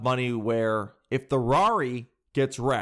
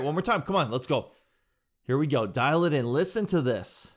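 ordinary talk, nothing marked

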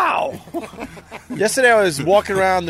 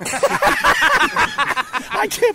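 joyful reunion; 8 semitones higher, much slower than usual. Real, not acted. Reply at the reunion.

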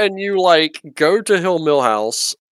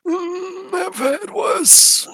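put-on old man voice; 12 semitones higher, 5 dB quieter, much slower than usual. GM voices injured Milhouse.

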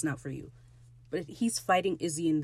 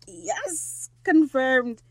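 excited cheer; 8 semitones higher, 6 dB louder, much slower than usual. Real, not acted. Fan cheering a pet name.

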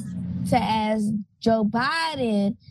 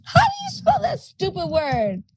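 exasperated wail; 7 semitones higher than usual. Real, not acted. Losing speller groans at the stupid word, exasperated wail. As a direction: wail it in exaggerated despair. Mock despair at misspelling a word.

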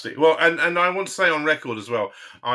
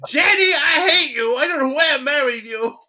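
much higher, louder mock-angry old man; hammed-up bickering husband at wife; 14 semitones higher, 6 dB louder than usual.

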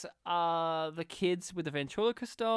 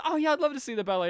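higher, louder and fast, a hammed-up Homer; Homer impression; 8 semitones higher, 6 dB louder, much faster than usual. Homer gushing about the ballet, fast and high. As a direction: gush about the ballet like a cartoon dad. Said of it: "He goes".

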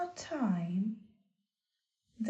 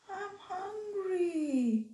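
small goat voice; 9 semitones higher, slower than usual. Acted. Second goat speaks.

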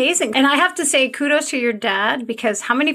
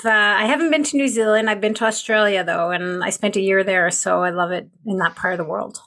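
normal talking voice

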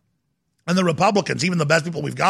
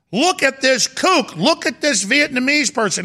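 high-pitched mock outrage; mocking John Oliver; 8 semitones higher than usual. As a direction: imitate a comedian scornfully pointing someone out. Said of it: "he's saying".

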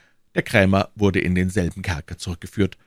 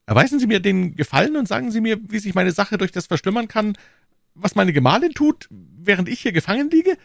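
very high, agitated grocer voice; beside himself; 4 dB louder than usual. A narrator's reading. Jailed grocer questioning the commissioner, frantic and high-pitched. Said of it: "rief der Krämer außer sich".

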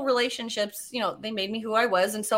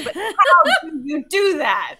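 loud, slow, higher insistent demand; exasperated amazement; 5 semitones higher, 7 dB louder, slower than usual. Retold or imitated. Woman pressing fiance for a real answer, loudly incredulous. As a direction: drag out the question loudly, half laughing. Insistent repeat of her question.

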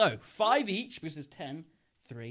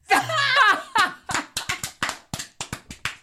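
long, high, loud laugh; burst of laughter; 7 dB louder than usual. Real, not acted. Storyteller cracking up at pongy breath, high loud laugh.

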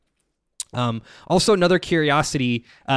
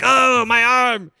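mock pained cry; 10 semitones higher, 4 dB louder, slower than usual. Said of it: "just like".

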